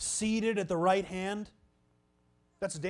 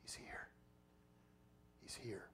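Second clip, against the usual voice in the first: hushed, awed whisper; 8 semitones lower, 22 dB quieter than usual. Retold or imitated. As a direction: breathe it out in wonder. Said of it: "Finally, John says".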